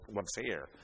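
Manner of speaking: ordinary talk, nothing marked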